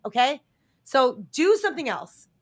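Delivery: ordinary talk, nothing marked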